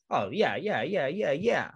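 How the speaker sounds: YEAH repeated in an up-and-down lilt; breezy sing-song. Retold or imitated. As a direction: bob the pitch up and down on each YEAH. Acts breezing through list in order.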